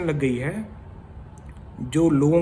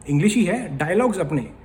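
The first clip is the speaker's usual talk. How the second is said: ordinary talk, nothing marked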